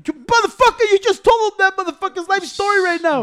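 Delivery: high, loud mock outrage; retold yell. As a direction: shout it up high, accusing. Adrian yelling, retold.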